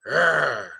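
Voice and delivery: imitated growl, rolled and voiced; bear growl. Shown as a demonstration. Teacher showing students what a bear is, imitated growl.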